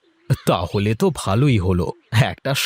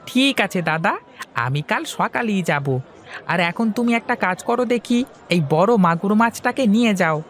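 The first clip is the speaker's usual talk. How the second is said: put-on high, eager young man's voice; young fish seller Hari; 9 semitones higher than usual. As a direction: talk up to your elder brightly. Hari agrees and offers a fish. (উঁচু গলায় উৎসুক তরুণ হরি)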